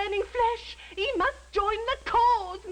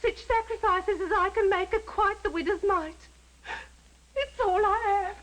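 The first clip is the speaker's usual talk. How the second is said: hammed-up martyred sobbing; wronged, weepy servant; tearful. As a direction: sob through each phrase, drawing out the last words. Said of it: "You needn't cry, Miggs".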